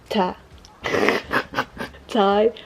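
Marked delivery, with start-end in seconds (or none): 2.1-2.6 tired repeated, each ending on a rise; practicing tired. Shown as a demonstration. French speaker drills tired for American friends, careful pronunciation. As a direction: try the English word tired, stretched and rising. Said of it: "Sorry, I'm tired".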